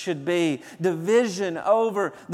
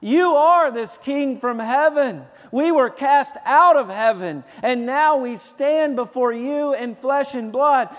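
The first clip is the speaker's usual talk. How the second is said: higher, louder mock demon voice; lightly voiced demons, raised and loud; demons speaking; 6 semitones higher, 5 dB louder than usual. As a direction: voice the demons crying out. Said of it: "demons even come to Jesus and they say".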